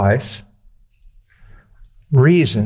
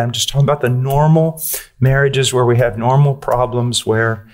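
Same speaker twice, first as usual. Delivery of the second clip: ordinary talk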